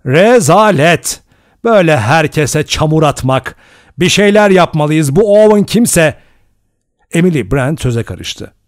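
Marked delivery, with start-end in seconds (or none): 0.1-6.2 loud, higher, indignant outburst; shouting. A narrator's reading. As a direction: lose your self-control in outrage. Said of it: "diye bağırdı". (öfkeyle, yüksek sesle çıkışarak)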